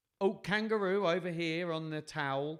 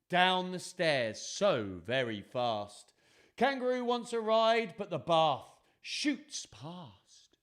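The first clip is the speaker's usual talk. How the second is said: excited storytelling with high animal cries; dramatic picture-book narration; 5 semitones higher, 7 dB louder, much slower than usual. A narrator's reading. Telling children of animals racing in, excited dramatic reading. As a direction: build the chaos, stretching the big moments.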